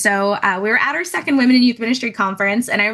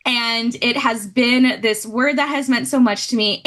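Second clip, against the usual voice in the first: normal talking voice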